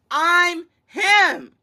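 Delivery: slow, high, loud bragging; cocky boast. Retold or imitated. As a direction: crow it like you own the court. Repeats Wemby's boast.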